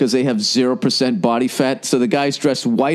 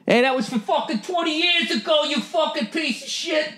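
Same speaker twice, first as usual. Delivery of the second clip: much higher, put-on tough-guy grudge voice; Hell's Kitchen body; 5 dB quieter than usual. The body holding a grudge, in a higher tough-guy voice. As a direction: snarl it like a bitter old street tough. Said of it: "what's your body from Hell's Kitchen".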